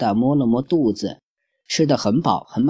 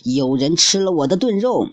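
a touch of angry bluster, higher; angry papa bear; 6 semitones higher than usual. Acted. Papa bear finding his stew eaten, mildly angry. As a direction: snap out the complaint. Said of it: "气愤地说道".

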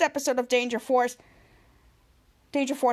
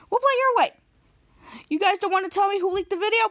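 high, loud and fast threat; fast high threatening rant; mock menacing; 6 semitones higher, 4 dB louder, much faster than usual. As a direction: rattle off the threat, worked up. Ray threatening the kids.

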